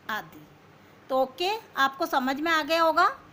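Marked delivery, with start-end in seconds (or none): none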